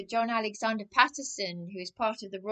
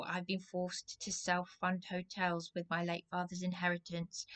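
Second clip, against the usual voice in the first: normal talking voice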